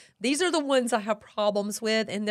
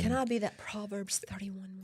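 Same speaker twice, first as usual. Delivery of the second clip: whispering; 8 dB quieter than usual. Real, not acted. Playful whispered aside to husband.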